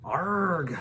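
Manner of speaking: high dismayed arg; dismayed groan. A narrator's reading. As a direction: groan at the mess. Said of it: "said Hiccup".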